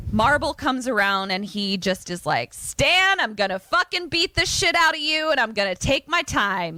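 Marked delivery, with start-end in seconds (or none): 2.5-6.8 raised-pitch threatening rant, lightly acted; hammed-up menace, pitched up; mock bully threat. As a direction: play the bully promising a beating.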